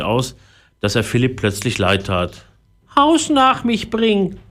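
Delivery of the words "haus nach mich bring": high, slow parrot plea; pleading parrot. A narrator's reading. Sad parrot asks Philipp for help, pleading high voice. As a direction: beg in a drawn-out bird voice. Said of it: "bat der Papagei".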